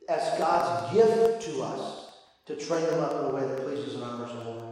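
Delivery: soft, low, solemn sermon voice; hushed earnest preaching. Real, not acted. Preacher to parents on God's purpose, hushed and earnest.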